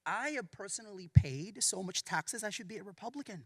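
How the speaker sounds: ordinary talk, nothing marked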